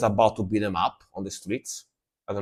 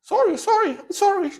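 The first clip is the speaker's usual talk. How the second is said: scared apology. Retold or imitated. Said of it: "He was like".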